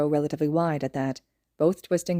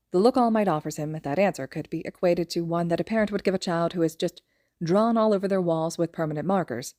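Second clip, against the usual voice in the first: plain reading voice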